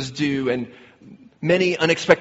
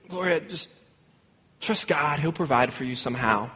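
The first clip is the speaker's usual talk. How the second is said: soft, unhurried comforting voice; retold gentle reassurance; 6 dB quieter than usual. Stranger comforts the crying wife, gentle reassurance. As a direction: reassure softly, as if consoling someone in tears.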